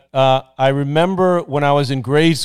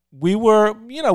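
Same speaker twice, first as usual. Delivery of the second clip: normal talking voice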